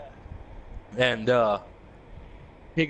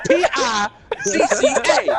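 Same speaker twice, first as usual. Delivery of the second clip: high and loud, letters spelled through laughter; laughing spelled out; 6 dB louder than usual. Retold or imitated. Wayne misspelling pizza to his mom, laughing. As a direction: spell it out while cracking up.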